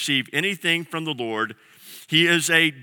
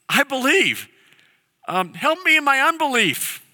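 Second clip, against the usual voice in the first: higher, pleading cry of a father; 8 semitones higher than usual. Retold or imitated. A father crying out to Jesus, pleading. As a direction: cry out for help. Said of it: "cries out".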